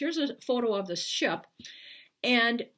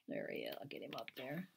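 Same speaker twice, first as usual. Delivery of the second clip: ordinary delivery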